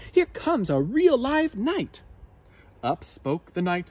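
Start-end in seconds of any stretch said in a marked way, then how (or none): 0.1-2.0 high, loud announcement; Madam Dragon announcing. A narrator's reading. Madam Dragon announcing the knight, high and loud. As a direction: present the knight. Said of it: "said Madam Dragon".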